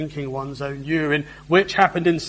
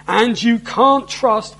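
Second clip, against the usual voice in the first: louder, higher, slow taunting enemy; mocking taunt; 5 semitones higher, 6 dB louder, slower than usual. Enemy envoy taunting the besieged people, mockingly. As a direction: sneer it out to the city. Preacher voicing the Rabshakeh.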